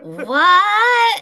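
loud, drawn-out high mock yell; imitated shouted "what". Woman with the TV on, imitated shout. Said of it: "Yeah, she'll say".

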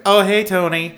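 high, loud greeting as in the film; "Oh, hey, Tony" in a high, mocking impression; imitating the film's audio. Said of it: "the way it sounds every time".

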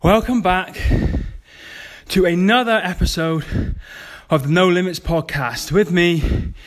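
panting out of breath after a workout; out of breath, catching air between words. Real, not acted. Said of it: "why I'm out of breath".

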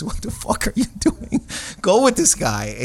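put-on a friend's girlfriend nudging him hard to go; lightly voiced pushy woman. Woman nudging the comic to go, high hissed urging.

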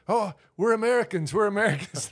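panicked movie captives. Retold or imitated. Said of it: "they go".

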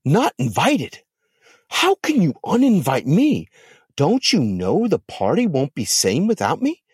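how dare she, wounded pride; indignant disbelief. Acted. Lilith learns she's uninvited.

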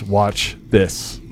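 ordinary talk

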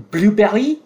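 put-on hesitant try at Blueberry in a French accent. Girlfriend retrying the title with store clerk, French accent. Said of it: "put that accent on it".

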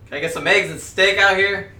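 loud call to the kitchen. Acted. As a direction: shout across the room. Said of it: "I'm gonna call and say".

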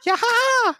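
very high, squealing little creature cheer; Korok cry. Acted. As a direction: taunt him with a piercing high-pitched "Yaha". Teases Mark with a Korok cry.

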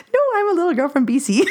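high, fast and laughing; laughing self-quote. Retold or imitated. Telling her friend how she answered in France, giggling. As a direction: blurt it out through a laugh. Quoting herself to French people.

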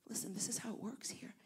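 hushed whisper; a whispered aside; whispered advice. Retold or imitated. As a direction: lean in and whisper the local rule. Pastors pulling him aside.

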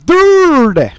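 DIRTY stretched loud and high on a long R; drawn-out R's. Shown as a demonstration. Explaining a nickname with ten R's, exaggerated DIRTY. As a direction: hit DIRTY with a huge, held R sound. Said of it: "With, like, ten R's".

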